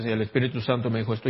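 lightly parodying someone who credits the Spirit for everything; mock fanatic believer. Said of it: "entonces todo era el Espíritu".

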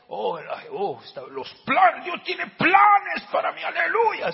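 high, loud gushing believer; mock religious fervour. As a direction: build into a drawn-out mock hallelujah shout. Parodia a creyentes entusiasmados con versículo. (parodia eufórica, aguda y a gritos)

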